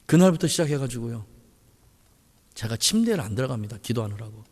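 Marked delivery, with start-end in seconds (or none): none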